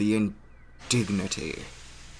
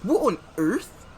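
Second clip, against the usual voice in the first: high startled cry; startled exclaim; 9 semitones higher than usual. Acted. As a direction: jolt into a shocked question. Startled by other engines.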